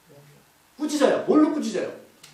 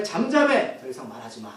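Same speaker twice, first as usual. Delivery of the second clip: raised order dropping to low and hushed; stern reprimand; 7 semitones lower than usual. Retold or imitated. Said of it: "강력하게 벌하고 책망하시고".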